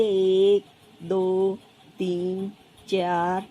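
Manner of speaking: low, drawn-out count, one number at a time; low monotone count with gaps; counting aloud. Acted. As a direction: count along slowly as you draw, pausing after each. Acts child counting while drawing stars.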